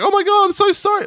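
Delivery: high, squeaky mock apology; mock heroine apology. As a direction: squeal it like a shocked scream queen. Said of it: "And then she's like".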